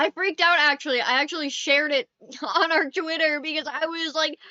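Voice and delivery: loud, thrilled and gushing; can't contain the excitement; excited. Real, not acted. Excited about dire wolves.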